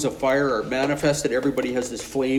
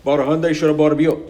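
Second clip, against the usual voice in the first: fake foreign language; 3 semitones higher, 7 dB louder, faster than usual. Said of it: "she goes".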